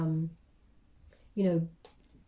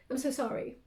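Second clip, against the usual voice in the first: emotional; 3 semitones higher, 4 dB quieter than usual. Real, not acted. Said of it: "I don't mean to be emotional".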